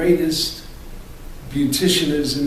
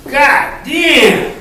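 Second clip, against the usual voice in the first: 1 mock startled cry; 11 semitones higher, 5 dB louder than usual. Pretends something attacked him.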